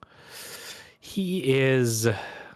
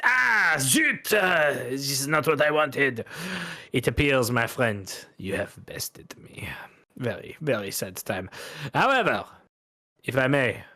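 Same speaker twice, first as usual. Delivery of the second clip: put-on French-accented gambler; 4 semitones higher than usual. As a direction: lose grandly in a French accent.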